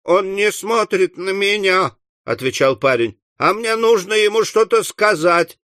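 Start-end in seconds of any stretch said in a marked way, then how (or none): 0.0-1.9 high, plaintive complaint; high-voiced grievance; whining boy. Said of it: "захныкал жирный парень". 3.4-5.6 high, insistent whine; plaintive high-voiced explanation; whining boy. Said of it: "захныкал жирный парень".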